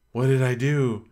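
gasp, then a mock-startled question; retold morning-after shock, pitch slightly lifted; alarmed gasp. Retold woman waking up alarmed.

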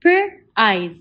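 Per-eyes split into onset and long vowel; segmented. Shown as a demonstration. Teacher sounding out Per-eyes for the class. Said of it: "One more time".

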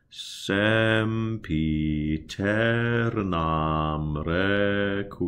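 "Sempiternam requiem" slow with open E, as in "bed"; slow choir demo; open E vowels. Shown as a demonstration. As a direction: spell it out slowly, syllable by syllable. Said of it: "those are the open E vowels".

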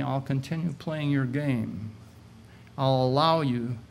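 ordinary talk, nothing marked

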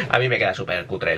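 ordinary delivery

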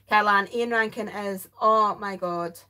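usual speaking voice

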